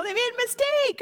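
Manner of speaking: high-pitched pretend delight; hammed-up gloating at a lucky break; mock glee. Mock celebration of found money.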